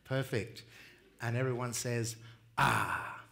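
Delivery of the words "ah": satisfied ah after a cold drink; refreshed ah. Shown as a demonstration. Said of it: "like you just had a nice cold drink".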